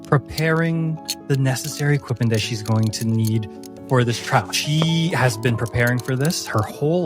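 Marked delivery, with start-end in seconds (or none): none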